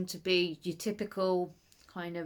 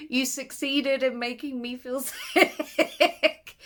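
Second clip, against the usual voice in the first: laughing; 5 semitones higher, 3 dB louder, slower than usual. Real, not acted. Laughing accusation to author.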